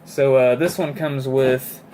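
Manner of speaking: ordinary talk, nothing marked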